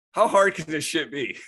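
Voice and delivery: loud, high scoff; scoffing disbelief. Retold or imitated. Grower jealous of roommate winning cups, scoffing. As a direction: throw it out as an exasperated challenge.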